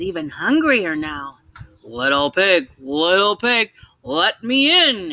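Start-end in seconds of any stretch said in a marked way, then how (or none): 1.8-5.1 a hungry wolf growling at the door. Acted. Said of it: "he growled".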